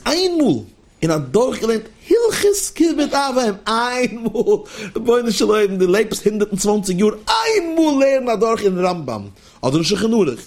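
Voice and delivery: urgent, emphatic rebuke from the pulpit; impassioned exhortation. Real, not acted. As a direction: drive the plea home, voice pitched high. Preacher exhorts listeners to learn once.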